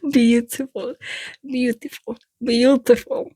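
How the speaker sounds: repeated tries in an Eastern European accent. Shown as a demonstration. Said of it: "Eastern European accent".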